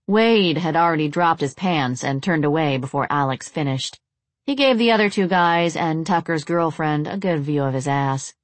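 plain reading voice

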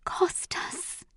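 high, faint, breathy call; weak, whispered longing; dying whisper. A narrator's reading. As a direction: breathe the name out with failing strength. Dying mother calls for her husband.

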